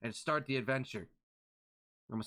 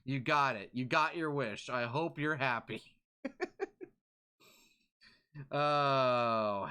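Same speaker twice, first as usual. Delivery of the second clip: normal talking voice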